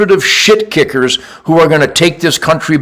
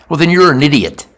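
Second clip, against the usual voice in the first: ordinary talk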